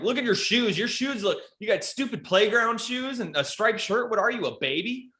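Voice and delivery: high, taunting playground roast; mock roast. Comic roasts a heckling kid in a high taunting voice. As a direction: tease him like another kid. Mocking a kid at the open mic.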